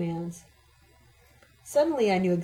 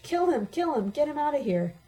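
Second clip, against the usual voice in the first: higher, frantic screech; pitched-up narrated screech; 6 semitones higher than usual. A narrator's reading. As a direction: scream it at the top of her lungs. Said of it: "and screeched".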